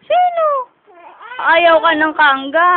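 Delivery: high, loud playful teasing; excited baby talk. Acted. Mother playfully teasing her fussing baby. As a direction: tease the baby with a squeal.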